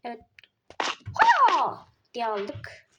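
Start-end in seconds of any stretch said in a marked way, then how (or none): 1.1-1.9 sharp ha. Real, not acted. Sudden loud exclamation in game.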